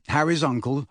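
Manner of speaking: ordinary delivery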